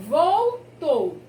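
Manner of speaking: "Voltou" broken as vol-tou, first part stressed loud; split into syllables. Shown as a demonstration. Teacher showing the L ending the first syllable, "Voltou" split as vol-tou.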